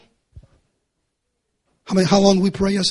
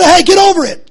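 "Hey, get over it" thrown out higher and louder; mock loud brush-off; 10 semitones higher, 9 dB louder than usual. Preacher mimicking a callous critic, loud mocking brush-off. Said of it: "You say".

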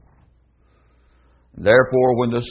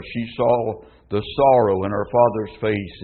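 ordinary delivery

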